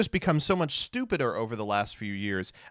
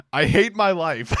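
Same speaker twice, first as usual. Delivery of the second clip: a louder line, breaking into laughter; laughing; 8 semitones higher, 7 dB louder than usual. Retold or imitated. Streamer reading the CoffeeZilla video title, laughing. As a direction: read it through a laugh.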